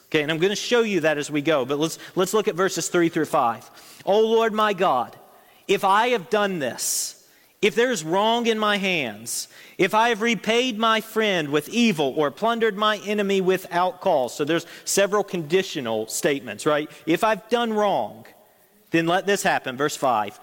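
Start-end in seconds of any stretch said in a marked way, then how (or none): 4.0-14.4 preacher reading a psalm aloud to the congregation, solemn; scripture reading. Acted. 17.1-19.8 preacher reading a psalm aloud to the congregation, solemn; scripture reading. Acted. Paraphrasing the psalm.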